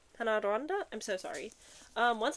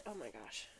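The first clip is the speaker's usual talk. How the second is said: awed hush; 10 semitones lower, 14 dB quieter than usual. Real, not acted.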